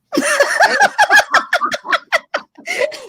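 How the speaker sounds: long, very high laugh; high burst of laughter. Real, not acted. Laughing at the host asking about rain in Seattle, high laughter.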